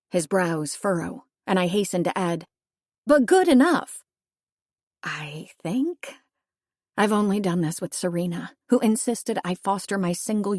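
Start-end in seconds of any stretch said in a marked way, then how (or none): 3.1-4.0 higher and louder, hurried reassurance; eager quick backpedal; hasty eager add. Acted. Hastily adds reassurance. 5.0-6.2 whispered, doubtful afterthought; whispered self-doubt; whispered aside. Acted.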